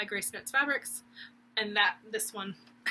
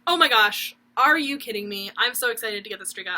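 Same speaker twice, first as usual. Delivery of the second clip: louder burst of mock delight, quickening at the end; retold excitement; 8 dB louder than usual. Recalling the new release to viewers, loud replayed excitement. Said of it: "I was like".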